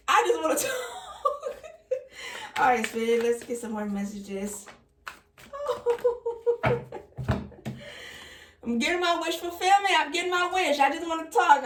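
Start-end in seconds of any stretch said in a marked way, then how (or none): 0.1-0.7 laughing. Real, not acted. Laughing at her wish. 4.7-11.6 higher and louder, delighted; excited. Real, not acted. Reader to viewers, delighted at the cards. Excited at her wish coming true.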